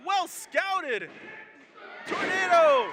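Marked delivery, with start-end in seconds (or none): 2.1-2.9 loud excited play call; excited shout. Real, not acted. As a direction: belt out the move name. Live wrestling call of move.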